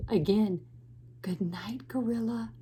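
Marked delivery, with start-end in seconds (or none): 1.2-2.5 very soft, falling into a whisper; hushed bedtime farewell to a sleeper; whispered good night. Acted. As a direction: whisper it as the animals drop off. Sleepy final good night in story.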